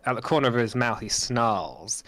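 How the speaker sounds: ordinary talk, nothing marked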